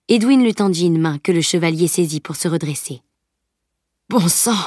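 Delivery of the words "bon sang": mildly pained moan of complaint; groaning. A narrator's reading. Beaten knight gets back up, groaning. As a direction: groan it as if winded. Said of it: "gémit-il".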